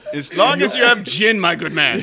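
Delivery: loud mock British gent; posh British toff. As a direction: play the gin-soaked colonial toff.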